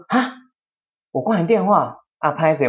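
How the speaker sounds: high, imitating mock-innocent disbelief; mock surprise. Quoting the old man denying he hung up, mock surprised. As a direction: play dumb, hammed-up astonishment.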